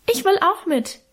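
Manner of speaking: put-on very high, small child's voice; high piping childlike plea; little boy piping. A narrator's reading. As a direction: squeak it out like the littlest brother. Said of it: "piepste unser kleiner Hermann".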